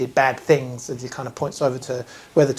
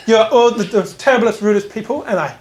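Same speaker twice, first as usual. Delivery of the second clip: acted huffy outburst, higher and louder at the start; teenage tantrum; 8 semitones higher, 5 dB louder than usual. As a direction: flounce through it like an offended girl. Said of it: "she just chucks a cough and goes".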